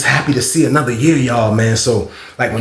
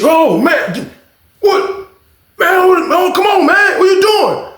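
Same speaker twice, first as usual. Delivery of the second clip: startled; slower than usual. Retold or imitated. Woken by noise, calling out high and alarmed. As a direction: caught off guard, yelling out.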